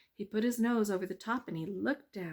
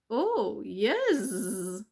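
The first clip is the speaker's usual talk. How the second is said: slow, loud sing-song bees; drawn-out happy bee chorus; buzzing bees; 2 semitones higher, 6 dB louder than usual. Acted. Bees teasing the mouse, slow and loud.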